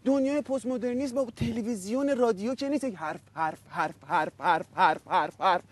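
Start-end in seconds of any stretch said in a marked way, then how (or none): 2.8-5.6 low droning mimic of chatter; lower, droning parody of talk; low mock chatter. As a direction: repeat the word in a low mocking voice. Mocking talk as empty chatter.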